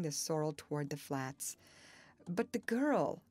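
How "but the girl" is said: higher, anxious objection; a touch of faltering unease. A narrator's reading. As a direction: object weakly, with worry. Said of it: "she faltered".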